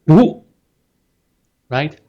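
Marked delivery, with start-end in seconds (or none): 0.0-0.4 sharp, loud, high 读; angry command. Shown as a demonstration. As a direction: bark 读 like a cross parent. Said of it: "put that with a very angry tone".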